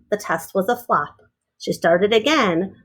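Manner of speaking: ordinary delivery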